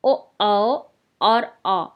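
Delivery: very slow, drawn-out ओ, औ and आ; teacher slowly sounding ओ औ आ; slow vowel demo. Shown as a demonstration. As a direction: stretch ओ, औ, आ slowly for the class. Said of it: "तीन वर्णों का उच्चारण हो रहा है".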